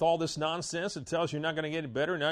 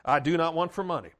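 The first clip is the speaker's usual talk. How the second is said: bold declaration; 5 dB louder than usual. Shown as a demonstration. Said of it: "it's just good to say".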